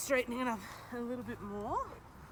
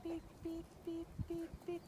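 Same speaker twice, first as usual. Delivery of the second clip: high, quiet beeping cue to the dog; 7 semitones higher, 10 dB quieter than usual. Shown as a demonstration. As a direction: chirp the beeps softly and high, like a signal. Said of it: "when I beep, she backs up".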